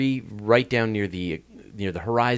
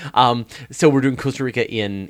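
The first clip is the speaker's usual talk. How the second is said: ordinary talk, nothing marked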